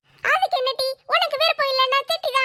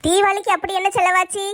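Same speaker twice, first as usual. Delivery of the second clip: lower, nagging wife asking for grocery money; wife's voice; 7 semitones lower than usual. Acted. Wife asking husband for shopping money, nagging. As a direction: play the demanding wife.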